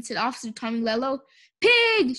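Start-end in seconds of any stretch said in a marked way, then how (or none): 1.6-2.2 high, loud accusing cry; shout. A narrator's reading. Eugenia blaming the pig, shouting. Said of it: "shouted Eugenia".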